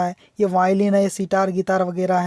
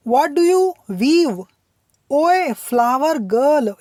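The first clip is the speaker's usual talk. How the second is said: poem reading; 4 semitones higher, 3 dB louder, much slower than usual. Shown as a demonstration. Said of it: "teacher reads poem line aloud".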